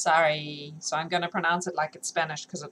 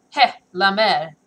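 gruff guard voice; 3 semitones higher, 9 dB louder, slower than usual. Acted. Hag greeted by guard.

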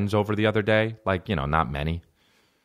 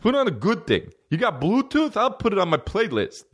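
high-pitched, whiny caricature of a friend; music buddy impression; 9 semitones higher than usual. Buddy asking for other music at a party, mock high voice. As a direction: pester like the imitated buddy, pitched way up. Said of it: "And he was just like".

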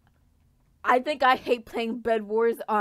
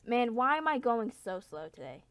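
usual speaking voice

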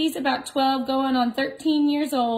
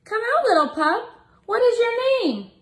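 high, coaxing storybook voice; reading to children; 12 semitones higher, 6 dB louder than usual. Acted. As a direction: coax the shy pup out, high and sweet.

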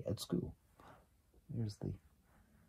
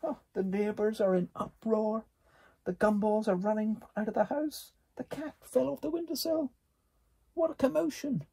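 much higher and louder, breathless excitement; excited commotion; 11 semitones higher, 4 dB louder than usual. A narrator's reading. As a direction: tell the chaos at full speed. Chaos after the explosion.

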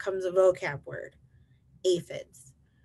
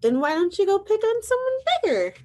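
very high, louder character voice; friendly ladybug; 7 dB louder than usual. Acted. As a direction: challenge in a high little bug voice. Friendly ladybug challenges the grouchy one.

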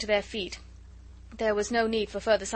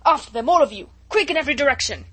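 loud, raised barking commands; furious orders; 5 semitones higher, 11 dB louder than usual. Acted. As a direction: drive the men out in fury. Chauvelin raging at soldiers.

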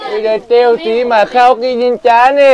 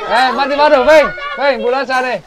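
ordinary talk

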